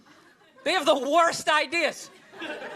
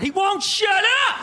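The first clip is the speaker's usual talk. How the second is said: higher, loud, slow furious shout; mock angry rant; 7 semitones higher, 4 dB louder than usual. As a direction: blow up at the TV like an irate fan. Mimicking the angry fan.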